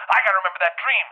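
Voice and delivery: high, loud excited exclamation; urgent self-reminder. Retold or imitated. Dreamer telling himself on waking, loud urgent resolve.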